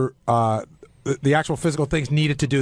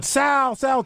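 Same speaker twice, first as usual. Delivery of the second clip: high calling out across the office; shouting a name down the hall; yelling a name; 8 semitones higher than usual. Retold or imitated. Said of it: "so I just start yelling".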